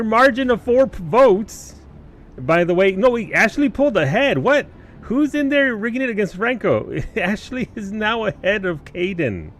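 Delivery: excited. Real, not acted. Poll suddenly flips, streamer reacts.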